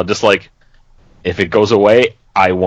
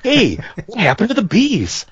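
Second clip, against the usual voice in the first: high-pitched celebrity impression; Mark Wahlberg; 11 semitones higher than usual. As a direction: pitch way up for a mocking actor imitation. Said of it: "He's still like".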